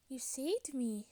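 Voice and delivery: normal talking voice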